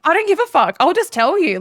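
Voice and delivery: high-pitched, brazen retold comeback; defiant retort. Boss retelling her retort before a client, defiant.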